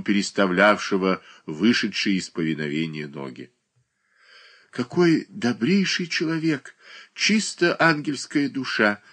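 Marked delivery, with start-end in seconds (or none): 4.7-9.0 high, admiring exclamation; sincere praise. A narrator's reading. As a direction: gush in earnest. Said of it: "воскликнула".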